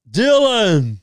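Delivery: loud, high, drawn-out name; big mock-heroic greeting; hammed-up movie call. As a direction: boom the name like an action hero. Calls out the famous film line.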